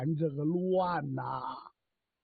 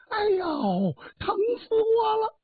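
high, loud cry of pain; screaming in pain; 3 dB louder than usual. A narrator's reading. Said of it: "大喊了一声". (痛得尖声大喊)

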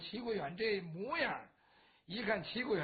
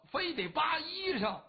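higher, slower, shouting the grievance; indignant outburst; 7 semitones higher, 3 dB louder than usual. A narrator's reading. Qi telling his sworn brother how the inn stripped him, indignant shout. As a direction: spit out the outrage.

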